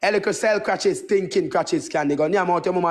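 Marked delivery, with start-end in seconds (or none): none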